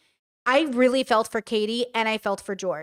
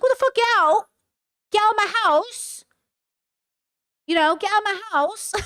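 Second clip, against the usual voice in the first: mock yelling boyfriend; 9 semitones higher than usual. Boyfriend kicking girlfriend out, mocking high yell. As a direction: ham up his screaming "get out". Voicing the boyfriend ordering her out.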